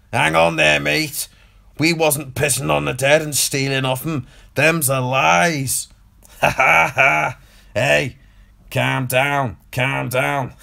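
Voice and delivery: put-on hamming up a Scouser telling you to calm down, Scouse accent throughout; mock Scouse accent. Mocking Scousers after Scouser-baiting.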